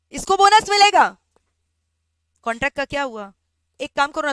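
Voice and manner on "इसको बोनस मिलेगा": emphatic declaration. Shown as a demonstration. Teacher repeats the verdict louder.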